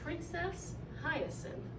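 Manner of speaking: ordinary delivery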